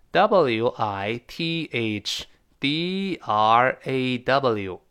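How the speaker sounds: each letter of "W I T H D R A W" said slow and separate; slow spelling. Shown as a demonstration. Teacher spells the word for students, very slowly. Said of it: "spelling the word".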